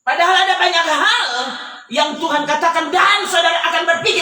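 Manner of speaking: loud and high, driving the lesson home; fervent sermon climax; preaching at full force. Acted. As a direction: proclaim it to the congregation. Preacher drives home the point.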